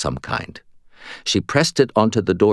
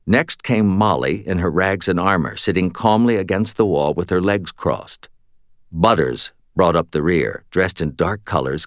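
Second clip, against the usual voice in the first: plain reading voice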